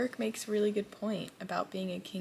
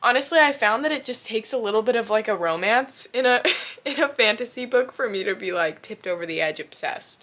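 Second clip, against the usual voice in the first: loud, fast and giddy; excited gushing; 3 semitones higher, 10 dB louder than usual. Real, not acted. Confessing romance makes her obsessed.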